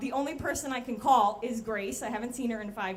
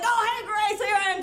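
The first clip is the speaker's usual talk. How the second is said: loud, higher-pitched fake cheer; overdone casual greeting; 8 semitones higher, 7 dB louder than usual. Narrator covering up cocaine use to boss Grace, fake cheer. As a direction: call it out far too brightly.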